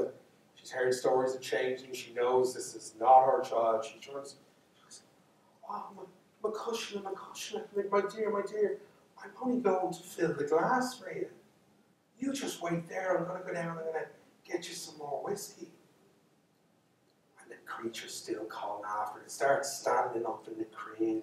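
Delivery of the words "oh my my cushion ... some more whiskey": high, soft, soothing voice; much higher, hushed pleading tone; placating mother. Acted. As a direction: coax the creature sweetly while scared.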